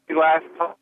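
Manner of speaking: normal talking voice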